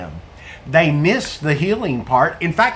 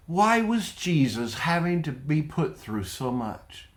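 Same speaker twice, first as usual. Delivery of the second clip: ordinary talk, nothing marked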